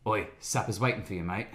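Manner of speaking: low, soft Cockney call; a London geezer calling you in to eat; low, quiet mock Cockney; Cockney accent. Shown as a demonstration. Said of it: "for my Cockney one".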